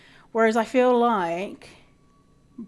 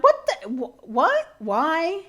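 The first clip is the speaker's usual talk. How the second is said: incredulous; 7 semitones higher, 4 dB louder, much slower than usual. Retold or imitated. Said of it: "And you're like".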